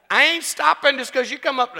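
high, loud playful defiance; mock scolding. Real, not acted. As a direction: tell off the interrupter in jest. Mock scolding someone coming on stage.

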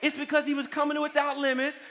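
high, loud sustained pulpit proclamation; full-force preaching. Real, not acted. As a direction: drive each phrase out to the congregation.

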